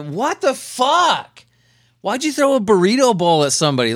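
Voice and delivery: can't believe what you just read; stunned disbelief. Real, not acted. Reading absurd burrito bowl news.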